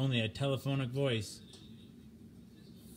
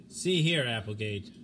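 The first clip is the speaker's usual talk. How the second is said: louder, sharp warning; stern rebuke; 4 dB louder than usual. Acted. The captain rebuking Applegate over the radio, loud stern rebuke. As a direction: pull a mutineer back into line.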